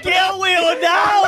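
high, drawn-out yelled insult; stretched-out high-pitched holler; exasperated shout. Real, not acted. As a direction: yell it in disbelief at your friend's blunder. Scolding friend for naming someone.

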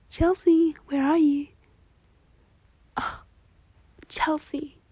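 loud call across the room. Acted. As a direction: shout for your friend. Calling out for Chelsea.

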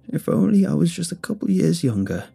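high, wistful woman's voice; deranged old woman. A narrator's reading. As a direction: muse longingly as the old lady. Deranged woman interrupts with wistful confession.

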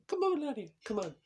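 put-on high, pushy Trump accent, coaxing; mock Trump pleading with his wife; Trump impression. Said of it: "I can't get out of his accent now".

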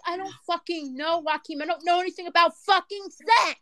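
high-pitched exasperated excuse; worked-up retold self-defense; retold defensive protest.

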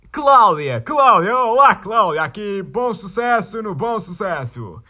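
loud, high, drawn-out calling of a name; excited calling out. Acted. Reading a fan's name, he calls it out loud and high. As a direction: sing out her name like greeting a friend across a room.

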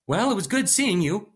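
high, loud forced-casual goodbye; fake cheerful scientist. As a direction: play it overly cheerful.

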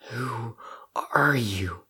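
halting, shaky question; soft, faltering start with a pause after "who". A narrator's reading. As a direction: ask it shakily, word by word. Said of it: "Crow asked shakily".

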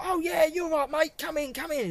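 very high-pitched breezy welcome; over-welcoming manager. Retold or imitated. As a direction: imitate a manager eagerly ushering mates in. Imitating manager waving customers in.

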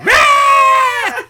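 high loud goat scream; laughing mock goat scream.

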